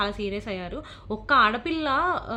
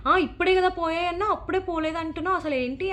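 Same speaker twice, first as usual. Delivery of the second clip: mock rude warden; 6 semitones higher than usual. Warden accusing the girl of changing her story, imitated high sharp challenge.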